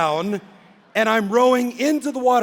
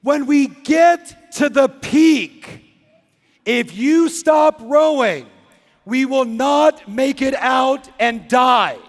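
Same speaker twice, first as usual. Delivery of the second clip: slow, higher shouted warning; screaming, slow; 4 semitones higher, 4 dB louder, slower than usual. Retold or imitated. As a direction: yell each word slowly like a panicked guide. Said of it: "she starts from this cute little screaming".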